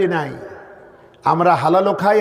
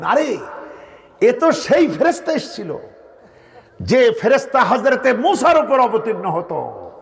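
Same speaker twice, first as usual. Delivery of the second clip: mock cry of joy, pitched higher; joyful cry; 5 semitones higher than usual. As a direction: retell an old man's delighted outburst. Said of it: "আনন্দে চিৎকার দিয়ে বলছেন".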